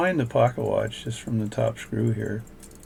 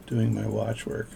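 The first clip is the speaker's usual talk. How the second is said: normal talking voice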